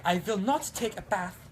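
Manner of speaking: put-on high, defiant child refusing a bath; a kid who won't take a bath, in German voice; stubborn German girl. A little German girl refusing her bath, high and stubborn. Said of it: "a kid just doesn't wanna take a bath".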